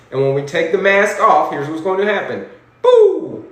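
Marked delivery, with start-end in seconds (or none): none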